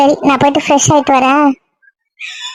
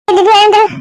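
calling out to wake her; 5 semitones higher than usual. Acted. Waking sleeping girl again.

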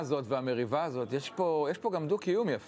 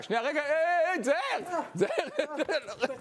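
calling out; 10 semitones higher, slower than usual. Acted. Host breaks up on-air brawl.

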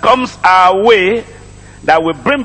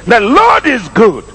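emphatic refrain climbing into a raised-voice scripture reading; forceful preaching; 6 semitones higher than usual. Real, not acted. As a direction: preach it to the crowd, rising in pitch.

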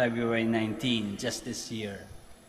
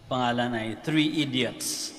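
ordinary delivery

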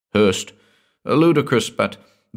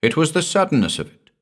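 high, quick, sheepish excuse; embarrassed and hurried; 10 semitones higher, faster than usual. A narrator's reading. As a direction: make a sheepish excuse, pitched up.

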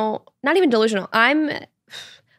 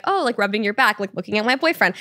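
ordinary talk

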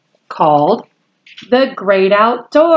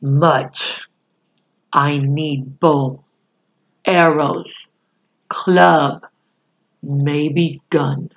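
Little Bear asking low and pleadingly, lower voice; 5 semitones lower, much slower than usual. Acted. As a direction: plead in a lower voice, words spaced apart. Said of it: "he asked pleadingly".

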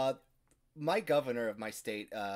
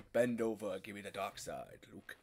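lightly voiced low, soft villain; Darth Vader; 5 semitones lower, 5 dB quieter than usual. Host parodying a helmet fetish, low villain voice. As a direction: murmur a mock villain's command. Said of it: "Darth Vader, but with bad accents".

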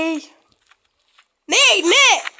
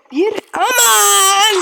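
drawn-out exclamation; 4 semitones higher, 5 dB louder, much slower than usual. Real, not acted. Game scare exclamation.

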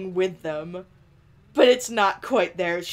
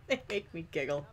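laughing through the words, higher squeals; giggling fit; 3 semitones higher than usual. Real, not acted. Gamer cracking up at Sim's ears, giggling. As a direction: giggle helplessly around the line.

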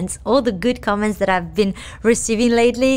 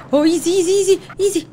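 tense; 8 semitones higher, much faster than usual. Real, not acted. Tense driving, cops chasing.

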